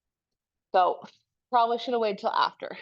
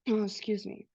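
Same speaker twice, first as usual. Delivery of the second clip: stuffy nose after a cough; sniffly; 5 dB quieter, slower than usual. Real, not acted. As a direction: talk through a sniffly nose. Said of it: "I'm a little more sniffly".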